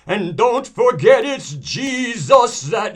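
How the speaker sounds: high, slow, shouted preacher wail; dramatic preacher. Acted. Preacher getting the congregation emotive about Jesus, hugely dramatic. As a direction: cry it out like an overwrought Pentecostal preacher. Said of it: "where they get real dramatic".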